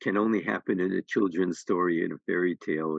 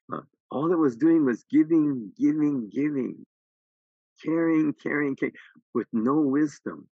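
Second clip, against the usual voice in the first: higher, louder, pressing each word home; exasperated emphasis; 7 semitones higher, 3 dB louder than usual. Real, not acted. The teacher protests the story's lesson, higher and louder with exasperation. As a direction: hammer the point with real exasperation.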